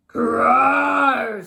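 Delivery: loud, high mock growl; a young lion's loud put-on growl. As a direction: growl at him like a cub lion. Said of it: "said the lion".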